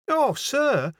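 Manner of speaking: high, agitated landlady; flustered Yorkshire landlady, pitch way up; wild cry. A narrator's reading. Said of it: "cried the landlady wildly".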